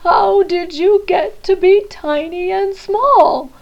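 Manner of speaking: a giant bear wailing over his tiny lost teddy; bawling. Acted. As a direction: sob the question out. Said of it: "the voice bawl".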